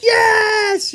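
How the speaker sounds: mock victory shout; cheering shout. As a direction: cheer like your team just scored. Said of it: "Kevin and Finch are like".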